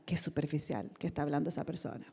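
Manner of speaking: low, soft, quick mock disapproval; desdén imitado. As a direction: imitate a disapproving aside under your breath. Imitates classmates' disdainful looks. (desaprobación imitada, en voz baja)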